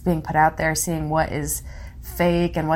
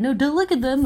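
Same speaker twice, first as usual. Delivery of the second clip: higher-pitched mock parental fussing; mock scolding parent; 7 semitones higher, 4 dB louder than usual. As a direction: play a panicky parent shooing eyes away. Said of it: "what are you gonna be like".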